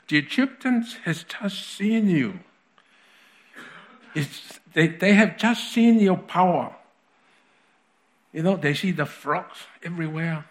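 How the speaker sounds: impassioned plea. Acted.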